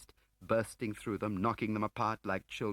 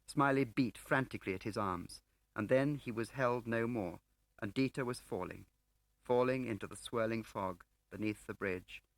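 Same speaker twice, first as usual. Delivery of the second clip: ordinary delivery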